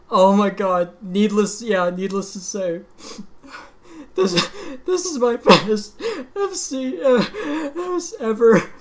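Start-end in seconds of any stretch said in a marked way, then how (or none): 4.1-8.7 tearful. Real, not acted. Gamer calling it his best full combo, voice tearful and high. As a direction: voice cracking high with tears. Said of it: "I'm literally fucking crying right now".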